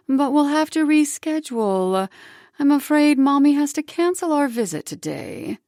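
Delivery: fake-sorry mom voice; falsely sweet mother. Mother canceling visit, falsely sweet.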